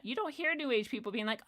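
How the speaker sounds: usual speaking voice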